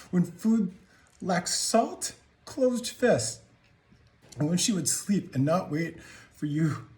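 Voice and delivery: high-pitched, emphatic accusation; anguished accusation. Acted. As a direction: hammer out each blow as an indictment. Anguished accusation in confrontation monologue.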